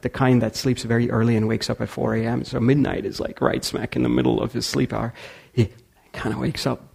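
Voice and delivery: ordinary talk, nothing marked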